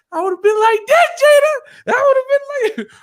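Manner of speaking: loud, very high falsetto; Mama Payne. Retold or imitated. Mama Payne throwing crispy combos, loud mock squawk. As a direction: shriek it comically, punching the words. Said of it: "he was playing Mama Payne".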